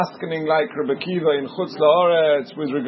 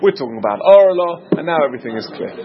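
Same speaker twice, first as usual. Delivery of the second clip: usual speaking voice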